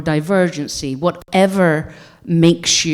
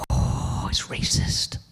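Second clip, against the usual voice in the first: whispered aside, much softer; 8 dB quieter, slower than usual. Real, not acted. Watching the trailer, a whispered aside. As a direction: breathe it out under your breath. Reacting to clip.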